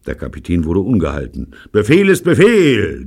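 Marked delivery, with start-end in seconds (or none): 1.7-3.1 loud, high thundering order. Acted. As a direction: thunder it at the crew. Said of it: "donnerte er". (donnernd und laut)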